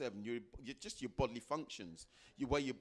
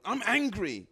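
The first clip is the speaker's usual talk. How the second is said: higher, louder angry declaration; angry client; 8 semitones higher, 10 dB louder than usual. Retold or imitated. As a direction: spit out the anger.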